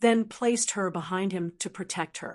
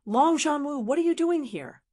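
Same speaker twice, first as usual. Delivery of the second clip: raised-pitch, mild narrated shout; shouted challenge; 6 semitones higher than usual. A narrator's reading. Long Fei confronts Long Zhanwu in his courtyard, mildly shouting. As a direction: demand it with lifted pitch, little added volume. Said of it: "he shouted".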